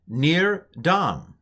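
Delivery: "nir dam" said slowly and evenly; Hebrew pronunciation. Shown as a demonstration. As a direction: sound out "nir dam" deliberately. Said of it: "here's how I would pronounce it".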